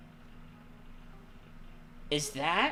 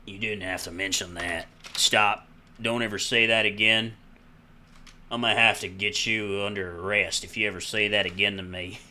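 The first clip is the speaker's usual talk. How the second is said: put-on Southern-accented cop. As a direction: warn them as a Southern lawman. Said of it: "he's gonna get a southern accent".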